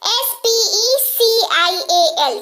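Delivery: S-P-E-C-I-A-L spelled out slowly; teacher spells it for the class; slow spelling. Shown as a demonstration. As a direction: lay out each letter slowly. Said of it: "एस पी ई सी आई ए एल".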